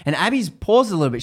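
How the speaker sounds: ordinary delivery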